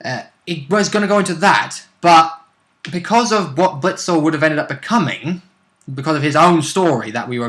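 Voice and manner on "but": usual speaking voice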